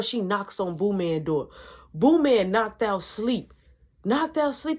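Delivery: ordinary delivery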